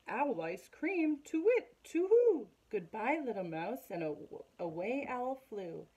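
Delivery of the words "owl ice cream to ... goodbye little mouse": very high, loud hooting panic; terrified owl hoot. Acted. Owl hearing it is the food, hooting in panic. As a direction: hoot it out in fright.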